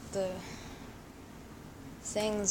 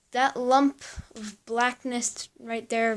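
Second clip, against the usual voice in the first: ordinary delivery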